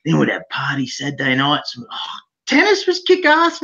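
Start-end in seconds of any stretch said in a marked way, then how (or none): 2.5-3.6 high, excited burst of nostalgia; enthusiastic. Real, not acted. Remembering youth tennis in Australia, enthusiastic. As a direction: lift the pitch with real enthusiasm.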